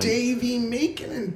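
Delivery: high squeaky sing-song; bright squeaky sing-song welcome. Acted.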